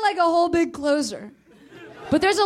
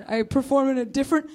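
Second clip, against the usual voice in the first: usual speaking voice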